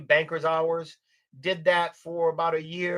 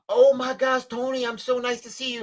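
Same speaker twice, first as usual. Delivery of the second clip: high, loud imitated delight; gushing old lady; 8 semitones higher, 4 dB louder than usual. Old client runs to former adviser in store, gushing. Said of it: "this old lady comes up to me".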